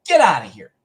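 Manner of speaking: loud, exasperated dismissal; angry, holding back screaming. Retold or imitated. Driver on the phone to ice cream guy, angry dismissal. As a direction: send him away forcefully, just short of a scream. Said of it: "I'm trying to not scream".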